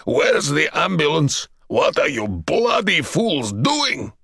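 slow, raging gruff roar; furious general. A narrator's reading. Wounded general berating the security men, furious. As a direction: tear into the fools. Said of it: "the general shouted".

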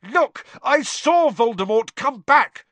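high, loud desperate shout. A narrator's reading. Harry shouting at Fudge from his bed, desperate. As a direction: yell it from the bed. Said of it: "Harry shouted".

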